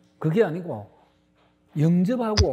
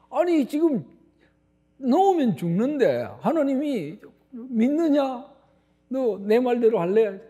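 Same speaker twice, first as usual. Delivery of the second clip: alarmed protest; 6 semitones higher than usual. Retold or imitated. Man panics at God's order.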